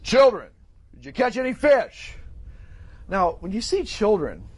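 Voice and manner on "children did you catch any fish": loud, raised call across water; projected, higher-pitched hail; booming voice. Acted. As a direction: call out to a far-off boat. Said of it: "in a booming voice, because it was a hundred feet out".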